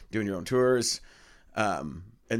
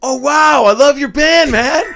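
gushing fan, much higher and louder; excited fan; 16 dB louder, slower than usual. Retold or imitated. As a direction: gush like a starstruck fan. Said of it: "and then he's like".

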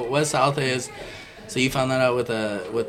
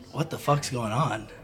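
low, subdued mock demand; retold dad's question; 3 semitones lower, 7 dB quieter than usual. Dad walking in on his handcuffed son, low baffled question. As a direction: ask it quietly, holding back.

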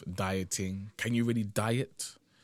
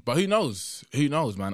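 raised, emphatic who knows; exclaiming; 6 semitones higher, 6 dB louder than usual. Real, not acted. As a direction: throw up your hands, who knows. Rhetorical exclaim.

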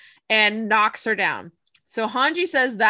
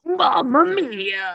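Aaron's Titan voice; 4 semitones higher, 4 dB louder, much slower than usual. Pretend. Cohost asks her to add it in Aaron's voice, slow put-on Titan voice. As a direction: stretch "Mamma Mia" slowly like a Titan. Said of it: "You gotta add Mamma Mia in that voice after".

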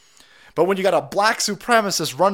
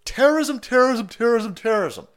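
higher, louder mock chant of terrorism, fading out; mock screaming; 7 semitones higher, 3 dB louder than usual. Mocking media crowds, a hammed-up chant fading down. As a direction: ham up the repeated cry of terrorism, trailing off. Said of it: "they keep screaming".

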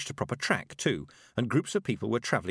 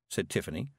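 ordinary delivery, nothing marked

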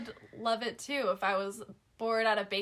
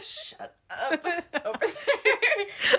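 laughing mock scold; 6 semitones higher, much slower than usual. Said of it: "And I'm like".

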